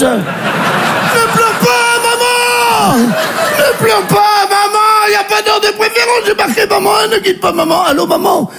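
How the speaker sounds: high, drawn-out wail of pleading; desperate pleading. Acted. Son begging his crying mother on the phone, desperate. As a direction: plead on one long high note, stretching the vowels.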